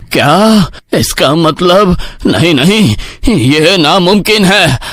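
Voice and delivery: high, slow horrified denial; shocked disbelief. Acted. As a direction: recoil in horror. Headman realises he is doomed. (डरा हुआ अविश्वास)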